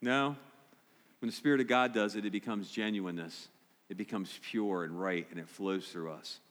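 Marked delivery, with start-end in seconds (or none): 1.2-6.4 hushed sincerity. Real, not acted. Preacher softens on Spirit's work.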